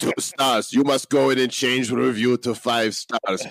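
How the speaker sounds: hammed-up Russian agent accent; mock Russian accent. Host jokingly threatening a reviewer with KGB, mock Russian. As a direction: threaten playfully in a thick fake Russian accent.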